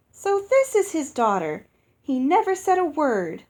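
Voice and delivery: loud, high, joyful giant hostess greeting; booming giantess. Acted. Giantess Uoxi welcoming her guests, loud and joyful. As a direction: boom it out like a delighted giantess.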